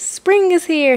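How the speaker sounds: high, bright shout of the news. A narrator's reading. Groundhog shouting news through the woods, excited high call. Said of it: "he shouted".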